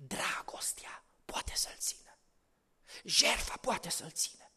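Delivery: slow whispered truth; hushed whisper. Real, not acted. Preacher tells the church softly, in a slow whisper. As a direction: whisper it as a secret revealed.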